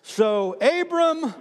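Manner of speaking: high, slow, loud call; slow loud preacher's chant; preached read-along. Shown as a demonstration. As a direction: lead the church in reading it aloud. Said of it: "Read those next three words out loud with me".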